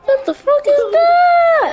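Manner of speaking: high, slow, stretched-out cry of disbelief; incredulous outcry. Real, not acted. Startled reaction to the game.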